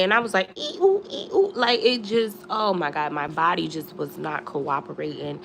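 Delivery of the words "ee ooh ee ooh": high squeaking joint noises; creaky Tin Man squeaks. Retold or imitated. Stiff body creaking like the Tin Man climbing the gate. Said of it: "felt like the Tin Man".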